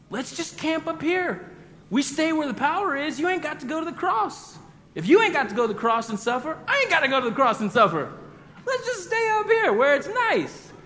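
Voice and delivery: high, coaxing voice trying to talk Jesus out of it; pleading Peter. Retold or imitated. Peter begging Jesus to stay on the mountain, high wheedling. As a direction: wheedle like someone who wants to skip the hard part.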